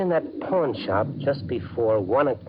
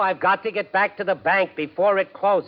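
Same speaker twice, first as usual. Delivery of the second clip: louder, higher exasperated retort; irritated shouting; 10 semitones higher, 4 dB louder than usual. Acted. Husband snapping at nagging wife, loud and irritated. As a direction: bark back, fed up with her. Joe snaps back at Molly.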